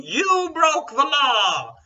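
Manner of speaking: high shrill-free mock yell at passersby; loud high mocking Trump impression; mock Trump shouting. Trump yelling at anyone who walks by, mock shout. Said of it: "just saying".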